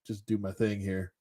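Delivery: lightly voiced [name], low and unbothered; imitating Snoop. Retelling Snoop at the show, low soft laid-back imitation. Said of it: "Snoop's like".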